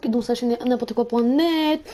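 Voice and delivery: normal talking voice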